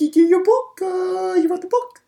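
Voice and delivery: high cartoon impression with a British accent; Stewie. Mimicking Stewie teasing about a book, high-pitched impression. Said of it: "but, but he's like".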